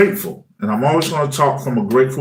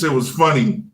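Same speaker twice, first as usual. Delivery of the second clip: normal talking voice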